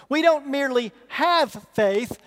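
ordinary talk

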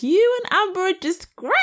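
goes up high, mocking her sweetness; high-pitched mimicry. Friend mocks Amber's high-pitched voice to Barnett, high and sugary. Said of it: "the way she goes high pitched".

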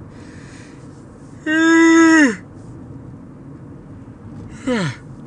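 long loud yawn; big yawn. Real, not acted. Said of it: "if you just yawned".

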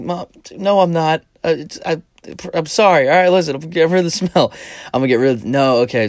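high, loud panicky excuses; flustered pleading. Retold or imitated. Son caught smoking, panicking to his mom.

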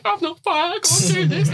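high, anxious I can't do this with a laugh; high, laughing mock panic before going out; panicked protest. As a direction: replay the panic half-laughing. Retold panic behind curtain.

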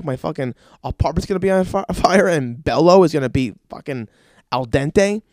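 ordinary talk, nothing marked

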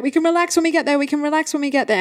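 high, rushed mock coaxing; hurried urging. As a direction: re-enact chivvying a tired child along on the run. Retold urging daughter while running.

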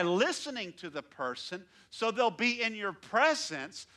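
rising sermon fervor, stretched words; preaching at full force. Acted. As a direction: drive the point home from the pulpit. Preaching builds up.

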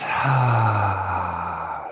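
loud breathy sigh out; slow demonstrative out-breath; long audible exhale sigh. Shown as a demonstration. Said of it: "And as you exhale".

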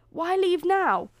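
slow, high, loud plea; protesting. A narrator's reading. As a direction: beg her for a reason. Prince protests her sudden departure.

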